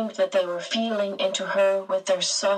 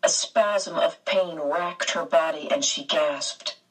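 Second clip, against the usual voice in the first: plain reading voice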